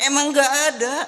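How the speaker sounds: mock-stern insistence. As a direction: insist with a grin. The Prophet insists loudly.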